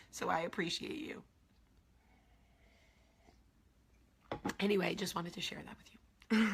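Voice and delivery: soft whispered gratitude; hushed, tender thank-you. Real, not acted. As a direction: whisper it gently, worn out from crying. Thanking viewers after crying.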